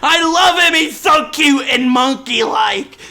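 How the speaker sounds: high, loud mock wail; mock despair. Gamer mourning the starter he lost, mock wail. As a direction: overdramatic grief for the monkey.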